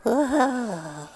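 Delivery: whispered, low whoa; awed whisper. Real, not acted. Child hearing the rich man's gift, whispered awe. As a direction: breathe it out in wonder.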